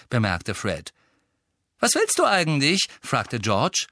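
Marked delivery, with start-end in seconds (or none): 1.8-2.9 high, raised challenging question. A narrator's reading. Brother confronting the elf, challenging. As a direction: demand what the elf wants.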